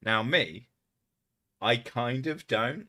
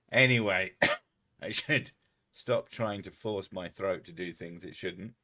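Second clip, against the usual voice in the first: usual speaking voice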